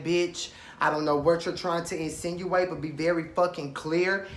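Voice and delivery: normal talking voice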